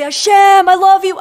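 high, loud cry of devotion; exuberant declaration. Retold or imitated. Believer to Hashem when life is great, exuberant. Believer calling out love to God.

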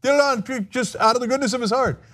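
high, sing-song mock of administration spin; mock Trump spokesman voice insisting he's transparent; mocking spin doctors. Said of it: "the Trump administration is all over T V going".

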